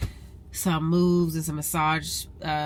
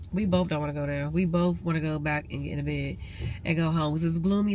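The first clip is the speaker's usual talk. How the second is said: usual speaking voice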